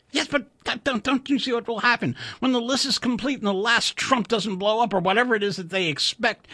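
high, anxious, halting engineer; high voice, slowing as the worry sinks in; worried Chuck. A narrator's reading. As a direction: stammer out the fear of being blamed.

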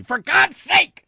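very high, loud, drawn-out outburst; furious yell. A narrator's reading. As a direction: explode at the pilot in desperation. Wilson yells at the pilot.

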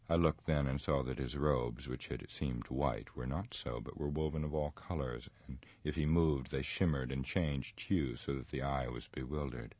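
plain reading voice

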